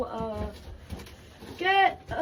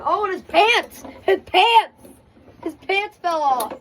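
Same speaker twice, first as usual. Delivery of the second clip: high, loud surprised shout; excited cry; 6 semitones higher, 8 dB louder than usual. Real, not acted. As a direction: blurt it out, caught off guard. Toy's pants fall off.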